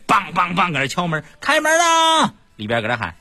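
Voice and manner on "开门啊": calling out. Retold or imitated. Police groomsmen banging on door.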